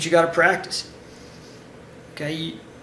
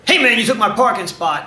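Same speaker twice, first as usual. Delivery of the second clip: loud, higher challenge; confrontational; 5 semitones higher, 6 dB louder than usual. Acted. Aggressive guy accusing someone over parking, confrontational. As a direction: come at them loudly over a stolen spot. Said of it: "the guy came up and he went".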